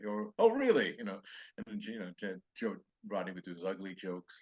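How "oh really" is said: much higher, louder "Oh, really?"; hammed-up surprised host; mock Johnny reaction. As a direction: feign amazement like a host. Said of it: "Johnny would be there going".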